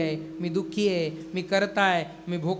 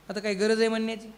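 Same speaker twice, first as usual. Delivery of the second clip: ordinary talk, nothing marked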